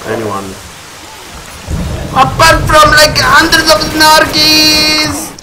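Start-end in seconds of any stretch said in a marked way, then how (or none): 2.1-5.4 high, loud, drawn-out shriek of alarm; shocked yelp. Real, not acted. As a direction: yell it in sudden horror. Sees a horde of mutants.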